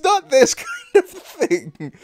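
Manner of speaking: high, loud words broken by laughter; laughing. Real, not acted. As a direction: protest while cracking up. Laughing at the game.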